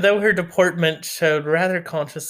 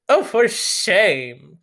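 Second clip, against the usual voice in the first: drawn out, slow and louder mock protest; scandalised old aunt; 4 semitones higher, 4 dB louder, much slower than usual. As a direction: stretch it out like a shocked aunt. Mrs Norris exclaims.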